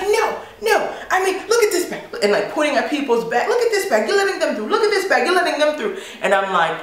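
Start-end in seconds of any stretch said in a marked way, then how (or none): 0.0-2.0 high-pitched mock outrage; livid protest. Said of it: "he is getting livid". 3.5-6.0 high-pitched mock outrage; livid protest. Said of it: "he is getting livid".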